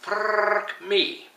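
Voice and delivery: frrrk with a long rolled R, high; frrrk, R rolled like a Scot. Shown as a demonstration. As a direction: trill the R in frrrk. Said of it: "roll your R's".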